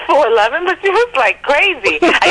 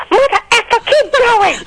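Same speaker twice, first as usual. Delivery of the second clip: angry yell; 13 semitones higher than usual. Retold or imitated. Girlfriend yelling at the cab driver to keep going, furious.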